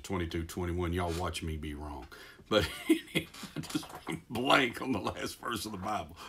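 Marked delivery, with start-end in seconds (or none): 2.5-6.2 real laughter breaking through the words, higher; laughing at himself. Real, not acted. Preacher owning up to going blank, laughing. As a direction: laugh helplessly through the admission.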